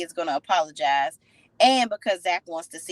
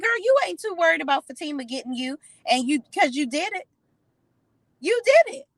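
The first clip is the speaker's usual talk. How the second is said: incredulous callout; 9 semitones higher than usual. Real, not acted. Viewer scolding Belinda for doing it, high incredulous callout. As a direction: call her out, voice climbing higher. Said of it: "I'm like".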